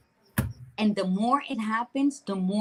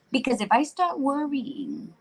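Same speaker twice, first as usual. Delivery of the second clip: ordinary talk, nothing marked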